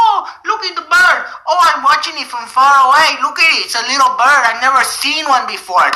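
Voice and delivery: mock birdwatcher. Therapist mocks the patient watching birds, high mock wonder. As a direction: gush like a dopey birdwatcher. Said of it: "You just see the birds".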